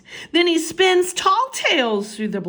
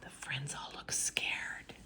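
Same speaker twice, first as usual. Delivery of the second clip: soft scared whisper; hushed whisper; 5 semitones lower, 16 dB quieter than usual. A narrator's reading. Narrator telling how the friends look, scared whisper. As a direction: hush as if afraid.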